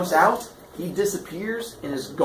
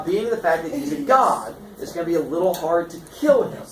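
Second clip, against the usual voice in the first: ordinary delivery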